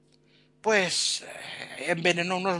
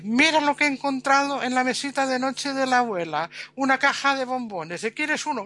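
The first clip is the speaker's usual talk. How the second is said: higher, bright offering voice; cheerful fiancée; 6 semitones higher than usual. Retold or imitated. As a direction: sweetly offer her a chocolate. (novia alegre, voz más aguda)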